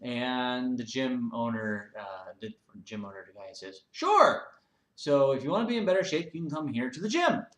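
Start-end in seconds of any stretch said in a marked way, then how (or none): 3.9-7.5 eager salesman. Acted. Eager gym owner pitching.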